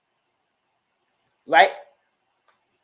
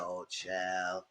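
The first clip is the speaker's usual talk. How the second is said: much lower and softer, a low knowing murmur; low, dry aside; weary oh child; 10 semitones lower, 13 dB quieter than usual. Retold or imitated. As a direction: sigh it out like an auntie shaking her head. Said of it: "I was like".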